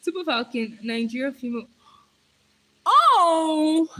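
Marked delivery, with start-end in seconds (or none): 2.9-3.9 loud, high drawn-out oh; sudden realisation. Real, not acted. Streamer suddenly understands viewer's football question, loud high exclamation. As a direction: let it burst out as it clicks.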